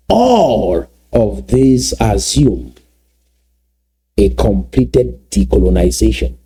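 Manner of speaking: words drawn out slowly, x0.4 at the start; slow, deliberate repeat. Shown as a demonstration. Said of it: "Let me repeat that slowly".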